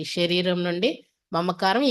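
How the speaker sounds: ordinary talk, nothing marked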